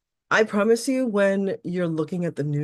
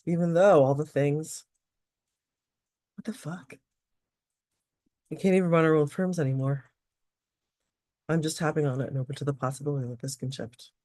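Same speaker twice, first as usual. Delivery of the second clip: repeat-after-me phrases with a sudden exasperated curse; tapping script. Acted. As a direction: guide the tapping, let the frustration burst out.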